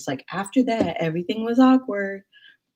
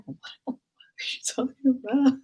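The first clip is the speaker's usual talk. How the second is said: incredulous whisper; 7 semitones higher than usual. Retold or imitated. Baffled reaction to moisturize excuse.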